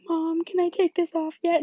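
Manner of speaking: put-on high whiny child pleading for relief; pleading kid. A hurting child begging mom to let her wash it off, high and whiny.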